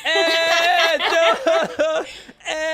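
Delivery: high, comic struggle against someone's weight; hammed-up effort of being pushed backwards; mock straining. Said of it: "and you're like".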